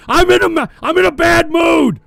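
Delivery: loud, high, exasperated shout; pissed off. Real, not acted. Streamer admitting his bad mood to the chat, loud and exasperated. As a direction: blurt out the bad mood at full volume. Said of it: "I'm just pissed off".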